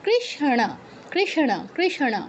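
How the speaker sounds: কৃষ্ণা sounded krisha-ṇa, n curled back; careful, deliberate কৃষ্ণা with audible ṇa; retroflex n. Shown as a demonstration. Teacher answering how it should sound, careful retroflex কৃষ্ণা. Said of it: "উচ্চারণটা কি হবে?".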